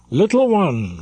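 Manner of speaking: lightly voiced captain calling her up; repeated call. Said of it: "repeated the captain".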